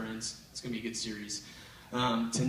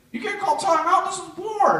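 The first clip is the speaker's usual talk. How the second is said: put-on high, louder kid voice refusing; taunting little brother; 8 dB louder than usual. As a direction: brother insisting it's war.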